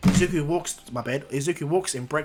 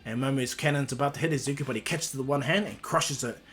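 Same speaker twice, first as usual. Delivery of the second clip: usual speaking voice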